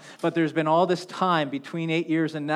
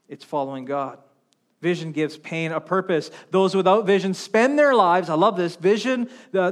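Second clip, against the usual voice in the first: ordinary delivery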